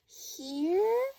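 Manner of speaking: put-on high questioning child voice; curious boy. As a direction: ask it like a small boy. Laszlo voiced in read story.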